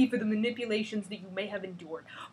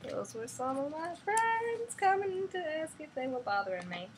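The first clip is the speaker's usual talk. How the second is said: wrong voice; 7 semitones higher than usual. Acted. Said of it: "I was reading that in the wrong voice".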